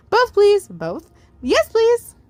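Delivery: put-on high, bright little kid voice; little kid asking for both; eager small child. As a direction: chirp it like a polite toddler.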